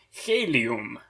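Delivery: "helium" said HE-li-um, stress on HE; "helium" with first-syllable stress, HE-li-um. Shown as a demonstration. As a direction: lean on the opening syllable of "helium". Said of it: "stress should go on the first syllable".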